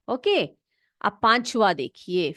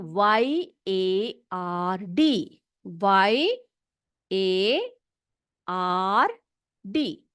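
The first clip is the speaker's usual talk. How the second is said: letters said slowly one by one; spelling out slowly; 2 semitones lower, much slower than usual. Shown as a demonstration. Teacher dictating spelling to children, slow letter by letter. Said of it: "yard का spelling देख लो".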